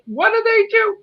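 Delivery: usual speaking voice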